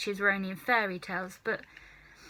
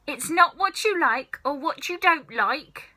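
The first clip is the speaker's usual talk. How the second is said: snapping; 6 semitones higher, 5 dB louder than usual. Acted. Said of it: "Grandma snapped".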